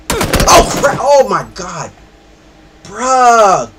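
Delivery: loud, high startled outburst. Real, not acted. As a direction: cry out from a sudden scare. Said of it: "'Cause ooh, this scared me".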